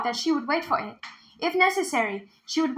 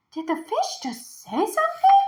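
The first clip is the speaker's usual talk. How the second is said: sudden high astonished question; startled question, higher; 10 semitones higher than usual. A narrator's reading. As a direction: gasp out the question in disbelief. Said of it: "she asked".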